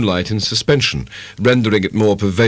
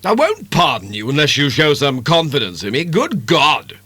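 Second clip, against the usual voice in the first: bursting out; 5 semitones higher than usual. A narrator's reading. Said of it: "he burst out".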